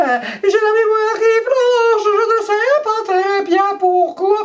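high, slow, put-on voice of the shaken maid; high mock voice of the maid; voicing the maid. Voicing the maid's account.

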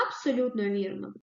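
ordinary talk, nothing marked

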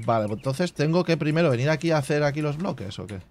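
ordinary delivery

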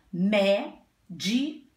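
"médico" split as "mé... di... co"; syllable by syllable. Shown as a demonstration. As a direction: say "médico" in three spaced beats. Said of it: "Vamos falar a palavrinha".

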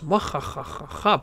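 evil laugh. Acted. Villain laugh over tombs.

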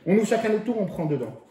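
ordinary talk

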